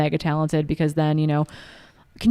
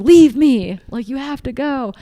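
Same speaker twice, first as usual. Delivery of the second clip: higher, pressing voice, lightly acted; urging pacer; 8 semitones higher, 6 dB louder, slower than usual. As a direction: urge him on, go without me.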